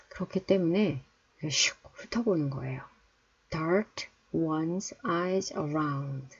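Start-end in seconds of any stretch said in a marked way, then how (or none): 3.5-6.3 very slow, each word drawn out; slow teacher demo; slow model reading. Shown as a demonstration. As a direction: spell it out slowly for learners. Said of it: "teacher models phrase slowly".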